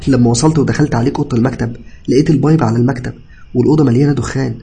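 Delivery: high, loud, agitated account; tearful explanation. A narrator's reading. Cousin recounting the collapse to patient, distressed. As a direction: explain urgently, still upset. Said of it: "اتكلم ابن خالتي وهو بيعيط".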